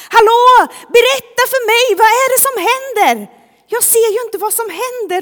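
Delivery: loud, high, pleading shout; calling out. Acted. Blind man asking the crowd what is happening, shouting. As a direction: shout insistently over a crowd for answers. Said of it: "han ropar så här".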